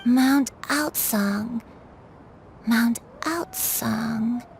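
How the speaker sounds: slow, wondering repetition; very slow, lost in thought; searching memory. Acted. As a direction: draw the name out as you try to place it. Granny repeats name, trying to remember.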